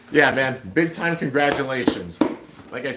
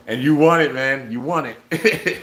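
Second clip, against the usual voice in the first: excited congratulations; 3 semitones higher, 5 dB louder, slower than usual. Real, not acted. Congratulating the first giveaway winner.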